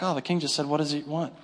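low, soft throwaway mumble; blowing it off. Acted. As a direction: toss it off as if it were nothing. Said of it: "you might blow that off".

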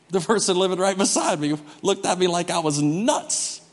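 ordinary talk, nothing marked

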